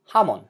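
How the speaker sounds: "jamón" wrongly as HA-mon; stress on first syllable. Shown as a demonstration. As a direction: punch the opening ja of "jamón". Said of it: "it would sound like this".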